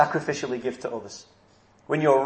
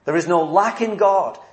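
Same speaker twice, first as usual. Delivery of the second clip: ordinary delivery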